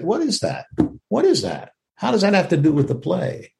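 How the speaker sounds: high-pitched incredulous questions; scoffing disbelief. Acted. Actor scoffing at dredging up memories for a play, incredulous. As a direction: pitch it up, baffled and dismissive.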